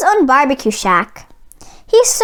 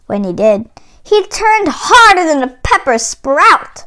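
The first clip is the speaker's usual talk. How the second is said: plain reading voice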